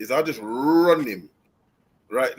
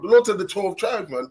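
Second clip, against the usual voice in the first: ordinary delivery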